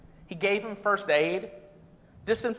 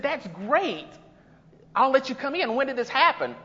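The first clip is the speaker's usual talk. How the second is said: lightly voiced Peter; 7 semitones higher than usual. Peter answers in the joke.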